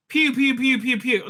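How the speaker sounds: high imitated laser fire; blaster pew pews.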